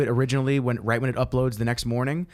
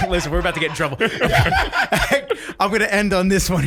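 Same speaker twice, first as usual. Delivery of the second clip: laughing; 6 semitones higher, 4 dB louder, faster than usual. Real, not acted. Laughs about getting in trouble.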